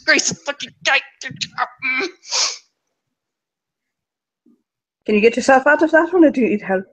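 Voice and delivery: high, loud, strained yell from under a car. Acted. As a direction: call out in pain and strain. NPC stuck under car.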